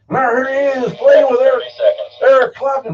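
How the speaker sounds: high, loud emcee announcing a star; announcer voice. Retold or imitated. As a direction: hype it like a stage announcer. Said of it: "you'll see them up on the stage and go".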